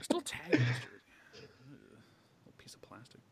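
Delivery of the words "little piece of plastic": slow whispered aside. Real, not acted. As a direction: whisper it slowly under your breath. Deadpan aside about the jersey.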